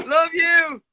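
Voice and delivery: high, laughing lovey goodbye; giggly late night call. Real, not acted.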